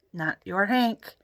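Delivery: plain reading voice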